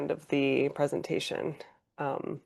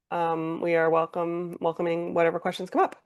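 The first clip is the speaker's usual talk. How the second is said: normal talking voice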